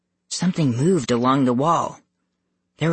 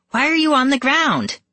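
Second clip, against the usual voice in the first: demanding; 12 semitones higher than usual. Acted. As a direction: demand it, high and sharp. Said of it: "she demanded".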